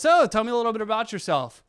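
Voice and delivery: a doctor cheerfully coaxing a mute patient, upbeat; bright coaxing doctor. Retold or imitated. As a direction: cheerfully coax a bored patient.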